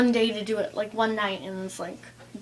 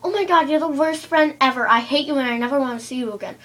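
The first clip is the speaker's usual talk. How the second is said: loud, overdone offended rant; mock dramatic friend; 6 dB louder, faster than usual. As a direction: ham up a friend's fake outrage. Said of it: "she's like".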